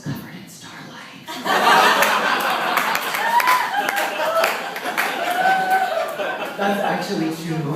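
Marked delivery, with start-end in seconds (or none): 1.3-6.5 long high real laughter. Real, not acted. Laughing at her childhood artwork. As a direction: laugh helplessly at the fairy drawings.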